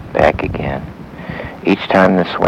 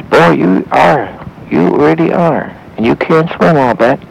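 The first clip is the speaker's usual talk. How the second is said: put-on old man voice; 8 semitones higher, 3 dB louder than usual. A narrator's reading. Old man talks to boy.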